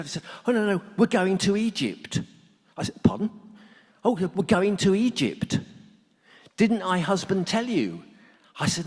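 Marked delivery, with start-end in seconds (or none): 0.5-8.0 wife's voice. Retold or imitated. Eric's wife on the phone.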